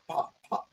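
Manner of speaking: soft unvoiced popping mimicry of gunshots; whispered pop pop. Explaining caliber to friends, he whispers the shot sounds. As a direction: breathe the pops out quietly. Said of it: "You know how they sound".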